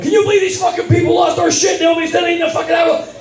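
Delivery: high shouted rant, fast at the end; husband ranting to his wife, shouting; furious shout. Retold or imitated. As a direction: storm in and vent at full volume.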